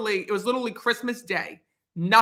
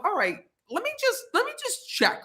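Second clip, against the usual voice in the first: worried inner voice; 12 semitones higher, 4 dB quieter than usual. Retold or imitated. Thinking to herself, worried.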